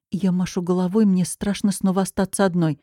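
ordinary delivery, nothing marked

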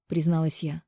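usual speaking voice

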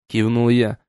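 ordinary delivery, nothing marked

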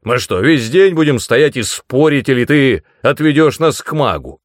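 loud, high, impatient demand; exasperated, forceful rhetorical question; sharp interruption. A narrator's reading. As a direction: cut in sharply and push for action. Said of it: "резко вмешался Ас".

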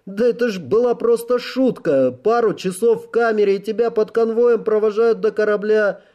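high, protesting officer; defensive officer. Acted.

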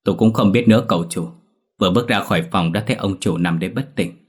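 ordinary delivery, nothing marked

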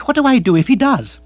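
high, loud anxious plea; agitated lord at a loss; panicky question. Acted. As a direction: cry it out in alarm. Agitated Bill asks for help.

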